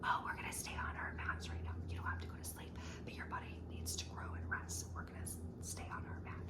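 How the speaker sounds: soft, unvoiced nap-time whisper; teacher whispering to a child at nap time. Shown as a demonstration. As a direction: hush them gently to stay on their mats. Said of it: "You're keeping your whisper voice".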